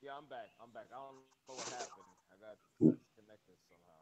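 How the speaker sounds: faint, distant, underwater phone audio; bad phone line. Real, not acted. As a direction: sound far from the mic, like underwater. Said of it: "You sound like you underwater".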